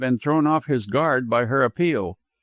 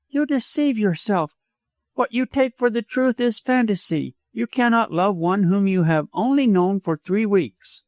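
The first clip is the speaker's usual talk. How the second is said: high-pitched woman dismissing a suitor; remonstrating widow; 8 semitones higher than usual. A narrator's reading. Widow dismisses doctor's love as fantasy, remonstrating. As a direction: reason with him in a high voice.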